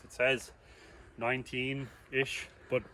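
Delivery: quiet, winded talk; short of breath on the climb. Real, not acted. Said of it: "and I'm dying".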